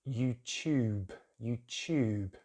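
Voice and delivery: YouTube as you-chube; British pronunciation. Shown as a demonstration. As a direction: say YouTube with a y glide in tube. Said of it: "British English".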